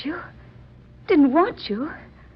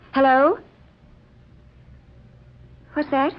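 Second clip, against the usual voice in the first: shocked, asking the operator again; sudden alarm into the receiver; startled on the phone; 5 semitones higher than usual. Acted. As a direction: pitch jumps higher in disbelief. Phone call, learns he left.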